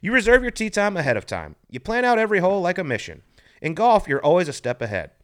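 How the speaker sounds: upbeat sponsor read, bright and punchy. Acted. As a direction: sell it like a radio spot. Reads a sponsor ad.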